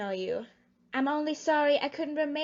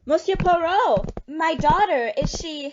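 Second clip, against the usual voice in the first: anxious plea; 7 dB louder than usual. Acted. Anxious mother demands news of daughter.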